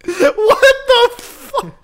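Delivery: shrieking high-pitched laugh; screeching laughter like a bird; pterodactyl shriek. Real, not acted. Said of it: "You sound like a fucking pterodactyl".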